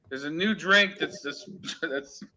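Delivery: breaking up laughing mid-sentence; can't get the words out for laughing; giggling through it. Real, not acted. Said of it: "I can't say without laughing".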